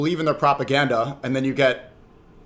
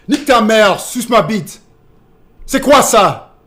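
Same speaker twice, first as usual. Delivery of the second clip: loud, high French outburst; shouted insult demo; 6 semitones higher, 7 dB louder than usual. Shown as a demonstration. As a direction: fire off French insults at full voice. Performing French insults again.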